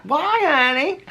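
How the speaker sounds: high, sweet talk to a tiny animal. Real, not acted. Cooing to the chipmunk.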